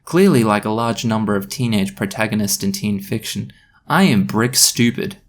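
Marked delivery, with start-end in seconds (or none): none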